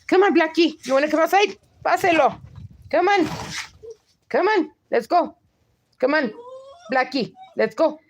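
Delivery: high-pitched coaxing call to a pet; short, urging calls to get the dog moving. Real, not acted. Calling the dog Blackie to go outside.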